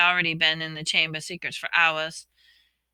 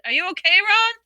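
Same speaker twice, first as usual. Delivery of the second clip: shouting; 13 semitones higher, 7 dB louder than usual. Acted. Calling through rockfall to Ron.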